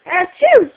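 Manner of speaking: loud, high-pitched "achoo!"; fake sneeze. Shown as a demonstration. Mother showing toddler a sneeze, playful fake sneeze. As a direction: do a big pretend sneeze.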